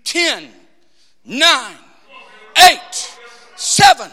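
loud, spaced-out knockout count; ringing count over a downed fighter; referee's count. Acted. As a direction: call the count like a boxing referee. I could hear the count.

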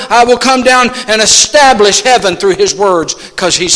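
high, loud, full-force pulpit cry; impassioned preacher at full volume; God's proclamation, preached. Acted. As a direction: thunder it out as a divine decree. Preaches as God speaking.

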